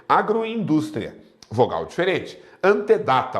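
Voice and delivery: normal talking voice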